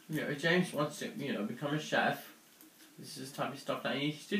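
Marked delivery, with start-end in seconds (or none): none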